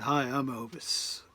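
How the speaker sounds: put-on slow, lightly drawled American [name] accent; a slow hint of an [name] croon; [name] impression. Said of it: "in this kind of [name] accent".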